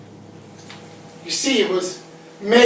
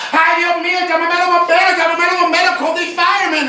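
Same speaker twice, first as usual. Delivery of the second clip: aunt's frantic cry, pitched up; panicked auntie yelling; 7 semitones higher, faster than usual. Retold or imitated. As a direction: mimic a panicking Puerto Rican aunt, higher. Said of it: "she started yelling".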